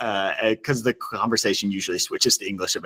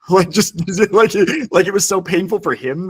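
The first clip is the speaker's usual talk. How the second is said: high, loud, laughing retelling; 10 semitones higher, 5 dB louder than usual. Real, not acted. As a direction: struggle to speak through laughter. Laughs at his own painful story.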